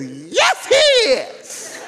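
piercingly high acted church shout; top-of-lungs shout. Old church mother answering the preacher, top-of-lungs shout. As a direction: shriek it out in irrepressible agreement. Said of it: "shouted to the top of her lungs".

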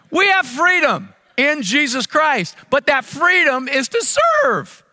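high, loud and slow declaration; rousing proclamation. Real, not acted. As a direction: proclaim it to the congregation. Pastor echoing the cry.

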